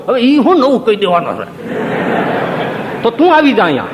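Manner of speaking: high, scoffing mimicry of a smug listener; mock know-it-all. Preacher mocking a proud listener, scoffing and high. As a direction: play the smug one who thinks he knows it all.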